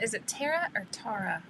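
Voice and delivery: "Tara" first like TAIR-uh, then like TAR-uh; two pronunciations. Shown as a demonstration. Asking a viewer how to pronounce the name, two pronunciations. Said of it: "say your name probably the wrong way".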